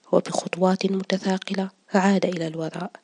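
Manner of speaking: usual speaking voice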